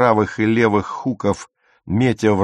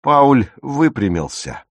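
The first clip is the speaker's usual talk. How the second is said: plain reading voice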